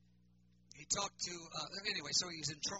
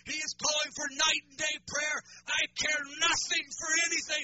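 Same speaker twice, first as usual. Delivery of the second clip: loud, higher, ringing declaration; fiery proclamation; 9 semitones higher, 10 dB louder than usual. Retold or imitated. Noel proclaiming to the pastors, a loud, high fiery cry. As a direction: belt it out like a revival prophecy. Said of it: "he sang in his, uh, beautiful South African accent".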